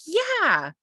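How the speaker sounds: venting to a partner who finally agrees, high and whiny; relieved venting. Retold or imitated. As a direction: pour out the grievance, high and aggrieved. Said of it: "And then you're like".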